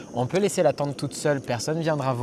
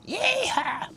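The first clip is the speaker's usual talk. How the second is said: cowboy yell. Acted. Cowboy cry.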